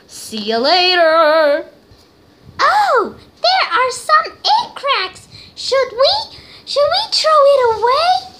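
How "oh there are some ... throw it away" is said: very high, cartoonish squeak; squeaky high voice. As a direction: play a tiny squeaky character. High squeaky voice for egg character.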